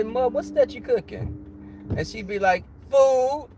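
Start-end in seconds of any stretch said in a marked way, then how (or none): 0.0-1.4 put-on higher childlike asking voice; child asking. Kid asking grandma what's cooking, pitched up. 2.9-3.5 short flat loud reply; curt answer. Retold or imitated. Grandma cutting off the nosy kid, blunt and loud.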